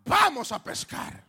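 shouted call to the group; booming shout; loud call. Acted. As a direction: call it out loudly as if across water. Preacher calls out quote.

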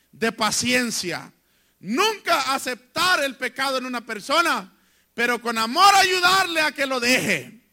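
loud, rising pulpit preaching with punched words; forceful sermon delivery, building to peaks; fiery preaching. Acted. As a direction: preach it hard to the congregation.

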